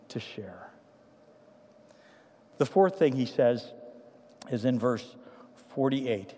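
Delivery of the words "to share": breathy unvoiced hush on the last words; whisper. Real, not acted. Preacher to congregation, hushed whisper. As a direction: let it fall to a whisper.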